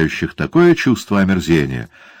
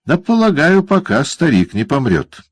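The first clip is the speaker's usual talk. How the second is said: much higher, sing-song mockery; malicious teasing dwarf; gloating dwarf; 9 semitones higher than usual. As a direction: savour the other man's discomfort. Quilp answers.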